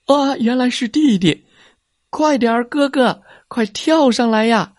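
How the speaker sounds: high, eager calling shout; excited shout. A narrator's reading. Little brother swinging in, calling big brother to jump. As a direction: call up urgently to be quick. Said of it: "弟弟大声地喊着".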